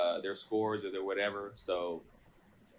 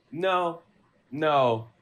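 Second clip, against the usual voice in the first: louder, higher mock denial; Light's raised, half-joking inner "no, no"; retold inner no; 5 semitones higher, 5 dB louder than usual. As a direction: blurt it out like a plotter refusing to believe. Light's inner reaction in his head.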